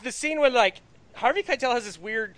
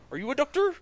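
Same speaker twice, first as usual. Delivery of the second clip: pursed-lip try at the line, a bit higher; attempted Harvey Keitel; 6 semitones higher than usual. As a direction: purse your lips and ask it. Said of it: "He, like, purses his lips".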